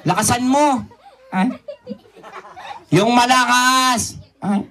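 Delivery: usual speaking voice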